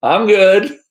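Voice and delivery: loud, high brush-off; firm brush-off. Retold or imitated. To the stranger in the Suburban, a loud high brush-off. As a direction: shut him down loudly. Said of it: "And I'm like".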